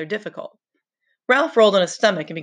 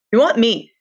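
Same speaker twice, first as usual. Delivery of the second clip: louder, resentful "We want meat"; antagonistic; 5 semitones higher, 6 dB louder than usual. Acted. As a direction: push back hard on "want". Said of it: "Now the antagonism was audible".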